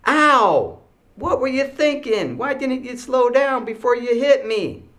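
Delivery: high, loud mock outraged kids; yelling out. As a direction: imitate hurt kids yelling in protest. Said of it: "two kids yelling out".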